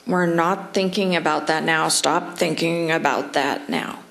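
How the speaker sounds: the wrong way: scold the thought; stern scolding. Shown as a demonstration. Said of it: "No, it's more of a gentle disengagement".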